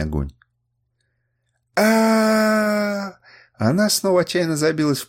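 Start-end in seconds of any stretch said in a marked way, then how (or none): 1.8-3.2 long high cry; drawn-out wail of despair; anguished cry. A narrator's reading. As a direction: cry out loudly on one sustained note. Bound girl cries out, struggling.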